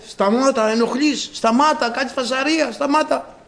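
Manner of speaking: high-pitched, repeated hushing; acted scolding. Crowd tells the noisy man to stop, high scolding. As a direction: play people nagging a noisemaker to stop.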